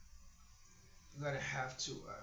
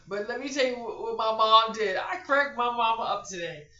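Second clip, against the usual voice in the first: loud and high, bursting to tell; eager to tell how she made her mom laugh; excited storytelling; 7 semitones higher, 14 dB louder than usual. Real, not acted. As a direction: lean in, can't wait to share it. Excited start of funny story.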